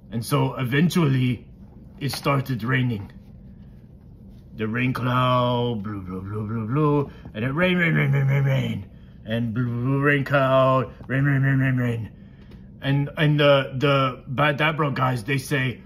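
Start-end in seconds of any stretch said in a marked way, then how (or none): 4.6-12.1 stretched, babbling storybook rain; playful rain effect. Pretend. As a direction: repeat words in a rippling run, like pouring rain. Acts out rain for child.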